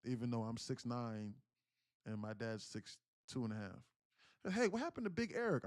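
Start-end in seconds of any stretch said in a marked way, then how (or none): 4.5-5.6 high, fast mock imitation of his mother; quoting mom. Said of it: "I'm quoting her".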